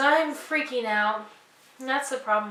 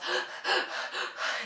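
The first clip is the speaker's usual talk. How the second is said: mock panting; 3 dB quieter than usual. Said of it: "So I was".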